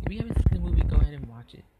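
ordinary talk

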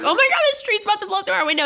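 high, fast, frantic gabble; retold panic. Storyteller re-enacting alarm at the window, high and panicked.

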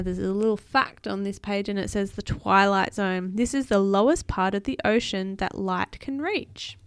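ordinary delivery, nothing marked

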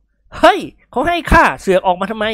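high, loud scolding shout; indignant yell. Acted. Bullfighter yelling at his servant who grabs the prize, indignant. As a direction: holler at someone grabbing your money. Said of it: "เอดตะโลเจ้าแห้ว".

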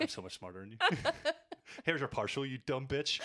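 mock a know-it-all postman laughing; smug postman. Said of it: "Just being like".

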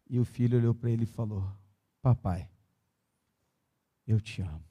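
soft, low, tender preaching close to tears; pregação terna. Acted. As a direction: speak quietly and let the emotion sit. Narração final terna e baixa. (pregação terna, mais baixa e suave)

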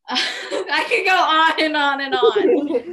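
laughing. Real, not acted. To her friends, laughing at how much she could say about her ex. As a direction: let the laugh spill over the line.

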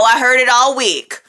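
ordinary talk, nothing marked

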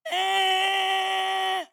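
loud high nasal honk; honking horn imitation. Passing car honking at him, loud honk imitation.

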